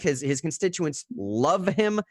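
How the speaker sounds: his constituents love him with love stressed, slow. Real, not acted. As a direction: lean hard on love in love him. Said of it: "I stress that word".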